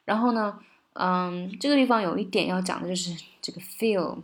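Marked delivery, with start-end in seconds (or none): none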